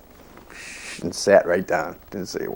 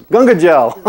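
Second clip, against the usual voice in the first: higher, louder ecstatic cry; joyful exclamation; 9 semitones higher, 7 dB louder, slower than usual. Retold or imitated. Prabhupada's sister splashing Ganga water on a devotee, ecstatic. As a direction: exclaim it with delight.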